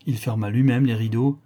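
ordinary delivery

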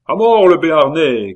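high, loud cry for death; crowd shouting. A narrator's reading. League crowd demands the Béarnais's death, shouting. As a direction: shout it like a mob slogan. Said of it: "crièrent plusieurs voix".

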